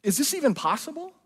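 confused question. Retold or imitated. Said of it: "The disciples are confused".

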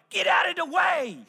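put-on high, loud little-kid shout; toddler yelling. Little girl weaving through passengers to reach dad, high bossy shout. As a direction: bark it like a tiny girl pushing through a crowd. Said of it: "I heard things like".

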